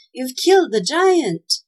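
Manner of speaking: high, loud, stretched-out marvel; admiring cry. A narrator's reading. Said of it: "he cried admiringly".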